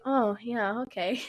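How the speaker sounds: usual speaking voice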